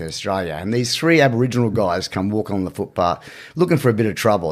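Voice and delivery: usual speaking voice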